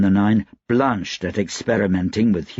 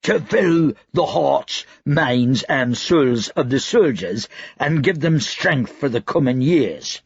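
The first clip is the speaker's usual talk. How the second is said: slow, high, self-important drawl; pompous cook voice; 5 semitones higher than usual. Retold or imitated. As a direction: puff it up like a pompous chef. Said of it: "took on an air of self-importance".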